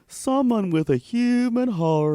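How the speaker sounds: dreamy, slow dog voice. Pretend. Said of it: "The dog's like".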